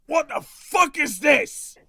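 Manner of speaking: high, very loud yell; alarmed shout. Acted. Game master voices a rattled gunman at the players, shouting. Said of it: "and he shouts".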